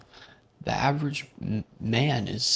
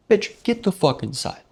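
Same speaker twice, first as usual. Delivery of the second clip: higher, louder hammed-up snap; mock snap; 10 semitones higher, 5 dB louder than usual. Narrator telling the guy off, high mock shout. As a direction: bark him inside in jest.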